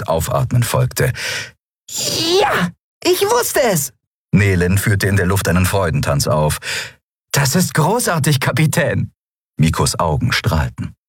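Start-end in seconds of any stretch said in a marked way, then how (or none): 1.9-3.9 very high squeaky cheer; high-pitched delighted outburst; jubilant. Acted. Crew member cheers good news. 7.3-9.1 high, eager praise for the captain; excited young admirer; delighted. Acted. Boy praises captain's good news.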